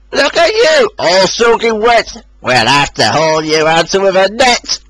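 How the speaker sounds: a hint of jeering trucks; mocking trucks. A narrator's reading. Trucks taunting soaked Rosie, lightly jeering. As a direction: taunt the wet engine. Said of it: "trucks laughed".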